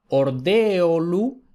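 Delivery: ordeolu very slowly, E and O apart; hiatus in ordeolu. Shown as a demonstration. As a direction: stretch ordeolu syllable by syllable. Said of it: "esto también es un hiato".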